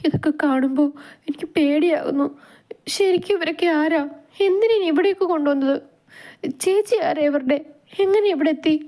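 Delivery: put-on high, frightened girl's voice; fearful questioning. A narrator's reading. Frightened girl asks the older woman who these people are, fearful and high. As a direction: ask fearfully, voice pitched high. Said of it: "Ammu ഭയത്തോടെ".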